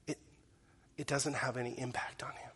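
a soft, unvoiced whisper; whispered under the breath; whispered aside. Real, not acted. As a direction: drop to a breathy hush. Hushed aside in sermon.

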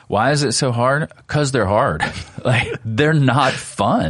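amused emphatic. Real, not acted. Answering with a laugh.

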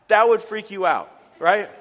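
ordinary talk

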